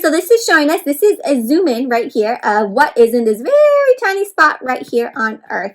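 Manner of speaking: ordinary talk, nothing marked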